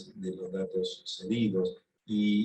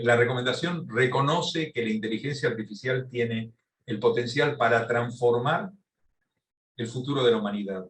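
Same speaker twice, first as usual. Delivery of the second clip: raised, projected lecture voice; firm, louder delivery to be heard; speaking louder; 2 semitones higher than usual. Real, not acted. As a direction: speak up clearly for a distant listener. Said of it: "hablo más fuerte entonces".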